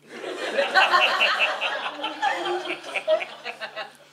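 high airy laughter; breathless laugh. Real, not acted. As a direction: laugh hard, almost silently. Laughing at the story.

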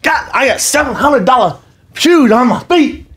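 high-pitched, slow boasting; drawn-out, high show-off exclamation; loud customer imitation. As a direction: crow about the shoes for everyone around. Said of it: "I'm talking in front of everyone".

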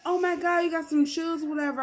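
high, loud mock squeal of delight; hammed-up excited gushing. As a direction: gush over the new shoes. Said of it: "I was like".

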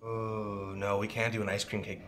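ordinary delivery

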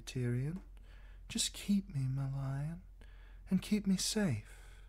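put-on a tender plea in a high young voice; high, soft pleading woman's voice. A narrator's reading. Shay begging Tyrion to keep her, high and soft. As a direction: lift the voice high and soften it, pleading.

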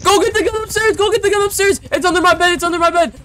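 high, loud frantic orders; panicked shout. Acted. Ordering Raph to fetch the gun, high frantic shout. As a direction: yell it like your life depends on it.

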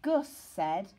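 usual speaking voice

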